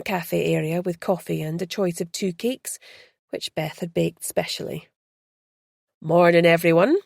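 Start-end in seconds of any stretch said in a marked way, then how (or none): 6.0-7.0 greeting the room, a little louder; calling out. Acted. Tour guide greeting his group, calling out. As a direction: call a hello across the shop. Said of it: "Rory called".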